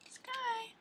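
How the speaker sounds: high sweet call to the bird; high pet call. Pretend. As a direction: call the parakeet's name up high. Calls bird's name sweetly.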